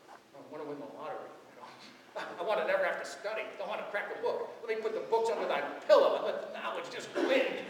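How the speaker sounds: fast, giddy, laughing list of lazy wishes; hammed-up selfish prayer; mock selfish wishing. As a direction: rattle off the wishes like a greedy kid.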